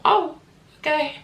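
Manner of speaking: high, awkward oh okay; flustered and caught out; awkward surprise. Retold or imitated. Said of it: "I was like".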